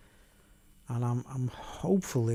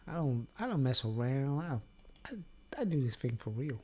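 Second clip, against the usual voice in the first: ordinary talk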